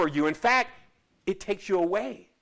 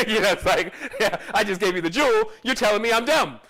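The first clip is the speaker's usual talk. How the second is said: usual speaking voice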